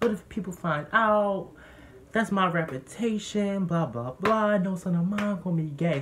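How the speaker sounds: high mock voice of an indignant father; imitated outraged dad. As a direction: imitate a parent ranting, pitched well up. Retold dad berating his son.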